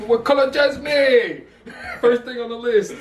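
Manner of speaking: high-pitched laughing through the words; giggling too hard to talk. Real, not acted. Co-host cracks up at name.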